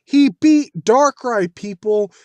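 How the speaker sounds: high, loud and slow, word by word; exasperated protest. Acted. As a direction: call out the crowd in disbelief, drawing it out. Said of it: "I'm like".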